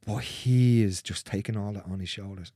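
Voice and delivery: normal talking voice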